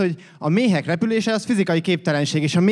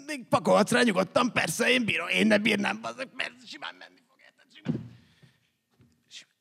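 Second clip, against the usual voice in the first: higher, strained voice, as if lifting something heavy; straining under weight; 6 semitones higher, 4 dB quieter than usual. Acted. Poet boasting he can carry the world, straining mock effort. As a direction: squeeze it out while holding up a great load.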